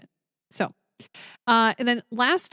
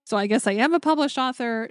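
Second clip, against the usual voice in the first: normal talking voice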